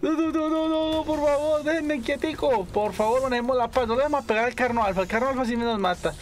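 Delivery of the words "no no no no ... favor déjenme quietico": high frantic begging; panicked pleading. Real, not acted. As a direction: plead to be left alone. Rodeado de dinos. (súplica aguda y asustada)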